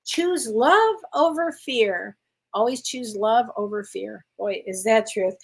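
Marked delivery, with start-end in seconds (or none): 0.0-2.2 slow, deliberate reading of the card's message, each word weighed. Shown as a demonstration. Reading the card to Amy, slow and deliberate. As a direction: let every word land, slowly. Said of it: "Here we go".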